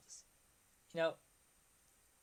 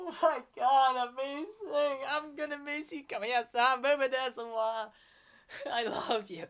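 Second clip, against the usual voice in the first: excited fan squeal; 6 semitones higher, 6 dB louder than usual. Retold or imitated. Fan screaming at idol.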